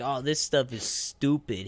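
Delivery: usual speaking voice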